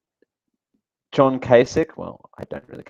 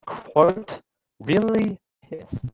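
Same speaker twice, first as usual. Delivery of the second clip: high, slow, drawn-out scoff; incredulous air quotes; mocking quote; 8 semitones higher, much slower than usual. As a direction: hold the quote up to ridicule. Reading a mocked quote aloud.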